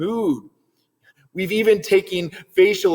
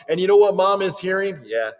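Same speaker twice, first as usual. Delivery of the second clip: usual speaking voice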